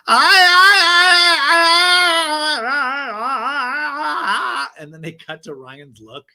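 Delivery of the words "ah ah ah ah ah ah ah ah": high, loud, strained wailing ah sounds; mock wailing healer. Doctor Lonnie at the therapy session, hammed-up high wailing cries. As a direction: wail out a fake healing cry, high and loud. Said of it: "there's a pause and she goes".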